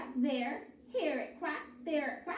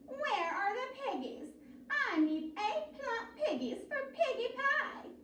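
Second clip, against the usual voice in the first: higher witch voice demanding; 9 semitones higher than usual. A narrator's reading. Witch demanding piggies from the cow, higher. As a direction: demand the pigs.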